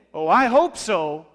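sarcastic hope. Shown as a demonstration. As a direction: stretch it out, dripping with doubt. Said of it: "it's how you say it".

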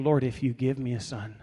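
soft, low plea sinking to a whisper; quiet bargaining with God, fading away; hushed private prayer. Acted. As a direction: pray it under your breath, desperate. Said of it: "She's not saying anything out loud".

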